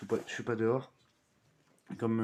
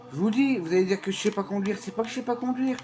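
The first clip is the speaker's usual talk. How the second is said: loud, higher-pitched heated defence; animated rant; 7 semitones higher, 4 dB louder than usual. Real, not acted. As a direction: argue your case, fired up. Defensive rant about driving.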